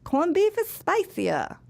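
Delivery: voiced mom, high and drawn out; mom's answer. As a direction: draw it out high, like mom explaining. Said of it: "And the mom goes".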